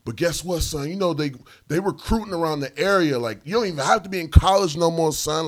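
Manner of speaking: high, loud recruiting pitch; imitating an excited stranger; over-invested stranger. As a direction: sell it eagerly at the top of the voice. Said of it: "he was so invested".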